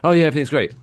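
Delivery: a bright, high brushoff; mock cheerful diner. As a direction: sound like a happy customer waving you off.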